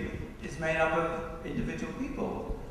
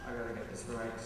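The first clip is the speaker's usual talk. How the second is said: usual speaking voice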